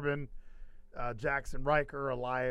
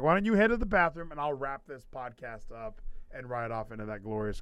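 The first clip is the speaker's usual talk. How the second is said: ordinary delivery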